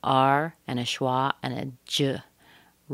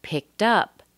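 UP higher and stronger than "picked"; stress on UP; 5 semitones higher than usual. Shown as a demonstration. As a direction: lift and punch "up" in "picked up". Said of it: "We're stressing the up more than picked".

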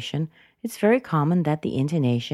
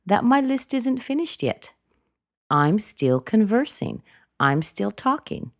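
rising ends on "yet", "conversing" and "talking"; unfinished upward intonation; 6 semitones higher than usual. Shown as a demonstration. Showing the list isn't finished, upward intonation. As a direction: keep lifting "conversing" and "talking" as if more follows. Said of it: "use this upward intonation to show".

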